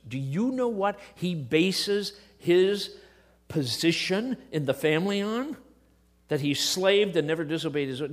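usual speaking voice

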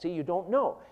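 ordinary talk, nothing marked

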